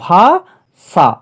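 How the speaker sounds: ভাষা drawn out in two parts, ভা and ষা; syllable by syllable. Shown as a demonstration. Teacher repeating how it was just said, syllable by syllable. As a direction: say ভাষা slowly, a gap between ভা and ষা. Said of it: "আমি যেভাবে বললাম".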